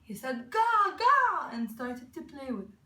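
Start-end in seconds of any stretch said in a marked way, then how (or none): 0.5-1.5 high, loud gleeful infant cry; loud, high happy squeal; happy baby babble. A narrator's reading. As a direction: crow it like a delighted baby. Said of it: "He was very happy".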